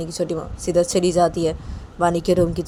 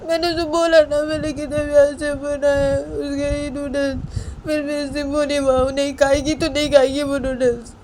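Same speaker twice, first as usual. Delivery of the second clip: high, loud mock-crying child voice; tearful wailing; 9 semitones higher, 5 dB louder than usual. Bani sobbing to chhoti maa over uneaten noodles, tearful wail. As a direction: wail it out, dragging the last words. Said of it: "रोते हुए तो बोलती".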